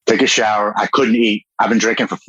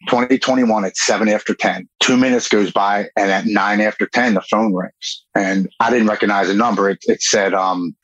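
normal talking voice

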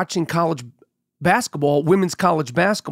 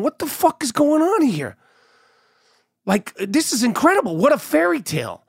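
high-pitched mock bewilderment; retold disbelief, pitched up; incredulous; 7 semitones higher than usual. Husband and wife watching the vows, incredulous. Couple's stunned reaction at the wedding.

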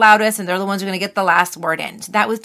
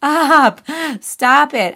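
firm mock yell, pitched up; acted yell; 5 semitones higher, 3 dB louder, much slower than usual. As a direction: act out yelling down the voice in your head. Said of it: "you've just got to yell".

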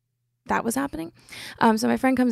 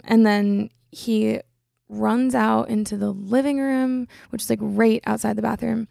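ordinary talk, nothing marked

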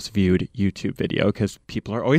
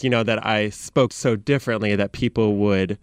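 ordinary talk

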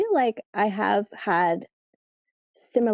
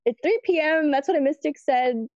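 higher-pitched, mildly frustrated retort; imitated frustration; 6 semitones higher than usual. Dad answering daughter's many questions, mock frustrated. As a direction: insist like an exasperated dad, playfully. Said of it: "he would get frustrated".

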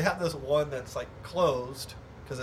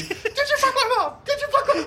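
high, loud screamed accusation through laughter; imitated screaming; 8 dB louder, faster than usual. Charlie attacking mall Santa, imitated screaming. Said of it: "he's just screaming".

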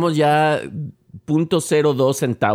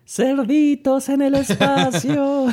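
slow high dramatic announcer; drawn-out spacey announcer line; Muppets announcer voice; 14 semitones higher, much slower than usual. Retold or imitated. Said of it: "los Muppets decían".

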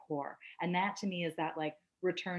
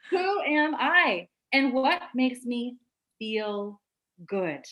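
laughing; 6 semitones higher, 7 dB louder, much slower than usual. Real, not acted. Laughing, slow question.

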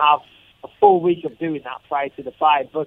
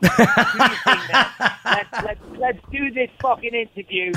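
laughing; 7 semitones higher than usual. Real, not acted. Laughing through his words.

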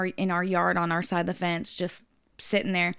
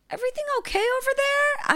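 high, sweet concerned neighbor. Retold or imitated. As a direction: sing it out politely, pitched way up. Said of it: "She's like".

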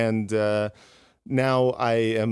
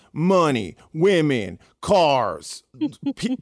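hyped-up TV pitchman; infomercial voice; 5 semitones higher, 3 dB louder, slower than usual. Retold or imitated. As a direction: sell it like a late-night ad. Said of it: "You sound like an infomercial".

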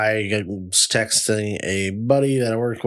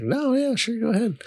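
mock high answer; 12 semitones higher than usual. Himself quoted.

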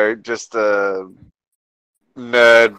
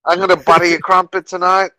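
put-on higher jokey British accent; lightly put-on Cockney accent, pitched up; hammed-up British accent, higher; mock British accent; 6 semitones higher than usual. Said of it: "British accent for the whole thing".

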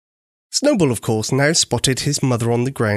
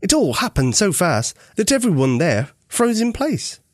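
ordinary delivery

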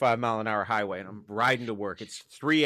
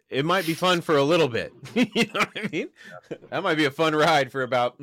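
laughing; 6 semitones higher, 5 dB louder than usual. Real, not acted. Laughing at boosting stock engine.

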